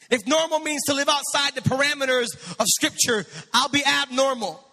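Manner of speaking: high, forceful declarations building in intensity; rally the church with each refrain; full-throttle preacher's cadence; impassioned preaching. Real, not acted.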